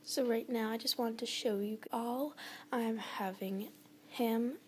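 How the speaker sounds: ordinary talk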